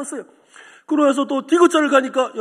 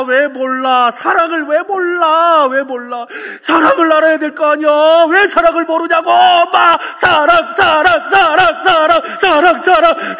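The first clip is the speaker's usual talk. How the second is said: higher, louder wailing cry; crying shout; 6 semitones higher, 11 dB louder, slower than usual. Acted. Desperate son wails at his mother, crying out loud. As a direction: sob it out, shouting at her. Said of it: "그렇게 울면서, 외치는데".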